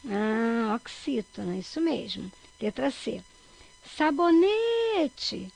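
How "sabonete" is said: sabonete called out, NE very long, high and loud; stress on NE. Shown as a demonstration. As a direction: call "Sabonete" stretching the NE. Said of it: "Qual que é a sílaba mais forte?". (sabonete com o NE bem longo e forte)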